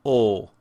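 unchanging "O" with no glide; pure O vowel. Shown as a demonstration. Teacher sums up where the sound comes from, pure "O". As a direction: hold a pure Swedish-style "O". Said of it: "and it is".

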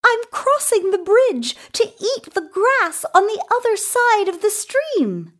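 very high, fast little goat; small creature, high-pitched and hurried. A narrator's reading. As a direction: chirp it quickly in a tiny goat voice. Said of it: "said the little Billy Goat Gruff".

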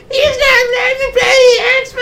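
very high falsetto screech; tattling brother. Acted. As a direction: squeal it out as a whining brother. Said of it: "My brother's like".

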